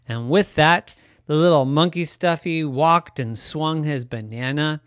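ordinary delivery

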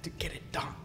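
breathy whispered aside. Real, not acted. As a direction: whisper it under a laugh. Laughing aside while retelling.

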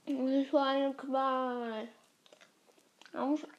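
talking around an ice cube; ice in mouth. Real, not acted. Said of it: "Ich konnte ihn wieder zerbeißen".

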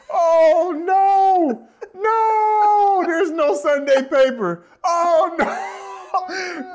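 helpless wheezing laugh through the words; laughing till crying. Real, not acted. Laughing hard at the memory.

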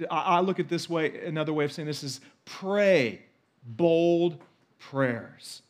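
usual speaking voice

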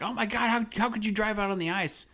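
high-pitched imitation of a panicking mom; mock freak-out. Host retelling the mom's reaction to the ice, mock freak-out. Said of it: "the mom's, like, freaking out".

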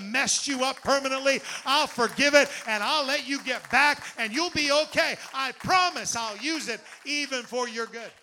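voicing God's promise. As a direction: build it up to full force. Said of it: "God says".

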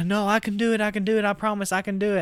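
high, fast, pleading; imitating begging. Elsa begging the chef to let her do it, high and quick. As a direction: plead high and quick not to be passed over. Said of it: "Elsa's begging Chef".

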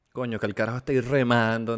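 ordinary talk, nothing marked

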